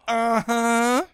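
a knowing, dry uh-huh; dry wife uh-huh. Retold or imitated. Wife catching him swearing on the stairs, dry knowing uh-huh. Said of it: "She said it just like that".

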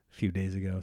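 usual speaking voice

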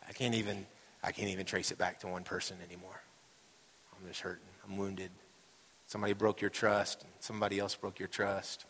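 low, soft, wounded confession; subdued hurt. Acted. Pastor voicing wounded churchgoers, subdued hurt. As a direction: let the voice sink quietly into hurt. Voices hurt members of congregation.

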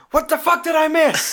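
high-pitched mock outrage; going mental. Film dad raging after the phone call, mock-mental. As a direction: imitate a furious dad, pitched right up. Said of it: "he goes fucking mental".

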